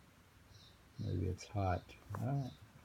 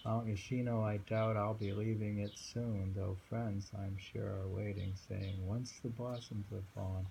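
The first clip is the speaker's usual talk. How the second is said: fading poem reading. Acted. Rereads the poem.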